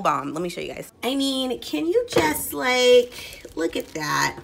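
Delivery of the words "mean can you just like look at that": excited. Real, not acted. Raving about mom's chicken.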